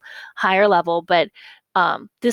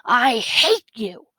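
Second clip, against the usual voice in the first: drawn-out, higher yelled quote; mild mock yell; 4 semitones higher, 3 dB louder, much slower than usual. Teacher reads out a character's angry line, mild yell. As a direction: stretch it out like a contained yell. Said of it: "So she yelled".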